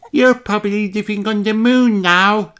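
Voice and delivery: high squeaky piglet greeting; squeaky little voice. Acted. Little Daddy Pig greeting the future, squeaky and high. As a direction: squeak it like a tiny boy. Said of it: "squeaky little voice".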